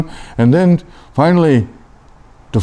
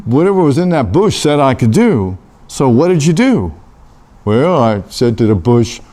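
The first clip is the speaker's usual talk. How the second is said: ordinary delivery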